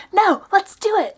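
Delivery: high, pushy mock voice; imitating insistent date. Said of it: "and he's like".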